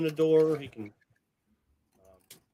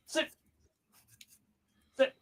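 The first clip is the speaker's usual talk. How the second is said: normal talking voice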